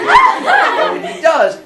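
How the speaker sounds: squealing high laugh through the words. Real, not acted. Teacher cracking up telling the class the myth, laughing. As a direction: crack up mid-sentence.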